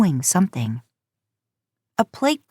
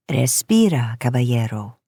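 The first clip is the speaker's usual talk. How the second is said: dry voice; 6 semitones lower than usual. Acted. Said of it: "Missus Gutierrez says in a dry voice".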